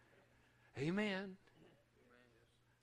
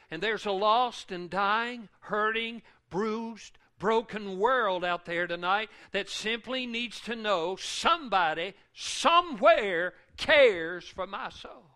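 loud, high-pitched preaching at full force; impassioned full-volume pulpit delivery; 5 semitones higher, 13 dB louder, slower than usual. Acted. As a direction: cry it out to the congregation, drawing out key words.

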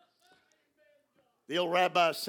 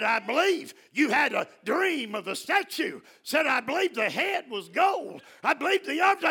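full-force preaching; 9 semitones higher, 5 dB louder than usual. Acted. Preacher shouting praise to the congregation, loud and high. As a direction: lift the word to a shout.

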